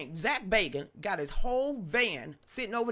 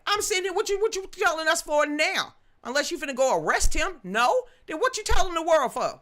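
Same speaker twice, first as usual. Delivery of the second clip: high, loud, fed-up questioning; real irritation; exasperated outburst; 8 semitones higher, 6 dB louder than usual. Real, not acted. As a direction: demand to know why now. Exasperated rant at whistleblower.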